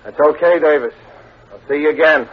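a gangster's loud parting threat; menacing vow. Acted. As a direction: promise payback through clenched anger. Gangster vowing revenge after robbery.

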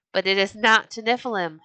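ordinary delivery

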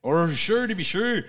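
a jokey stab at an Irish lilt; mock Irish accent. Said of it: "You don't have an Irish accent".

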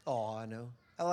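ordinary delivery

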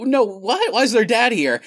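high, loud gasping disbelief; mock shock. Reliving the movie's opening for the hosts, a shocked high cry. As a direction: squeal it like a startled viewer.

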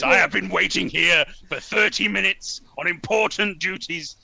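booming, very loud indignant complaint; bellowing. Acted. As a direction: bellow it with pompous outrage. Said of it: "Very low, bellowing voice".

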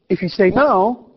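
ordinary delivery

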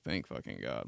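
low, soft relief; relieved mutter. Retold or imitated. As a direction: sigh it out, relieved. Relieved kid with dad.

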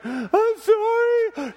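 very high, stretched-out wail; mock crying child. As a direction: imitate a little girl sobbing an apology. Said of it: "then she starts crying".

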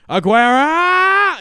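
long, very high, loud stretched commentator yell; Martin Tyler goal call. Retold or imitated. Friend imitating a football commentator, long high yell. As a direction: hold the name like a goal call. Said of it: "that's Martin Tyler".

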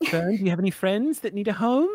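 a touch of high pet talk; baby talk. Acted. Tara asking the dino, lightly high pet talk. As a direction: sweet-talk the little pet.

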